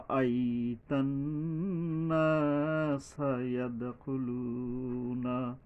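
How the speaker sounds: very slow, drawn-out chanted recitation; tajweed chant; Quran recitation. Shown as a demonstration. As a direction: chant each word, holding the long vowels. Recites the verse.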